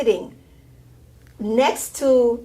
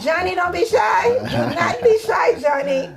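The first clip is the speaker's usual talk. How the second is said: coaxing call; 8 semitones higher than usual. Real, not acted. Host calling out to shy guest.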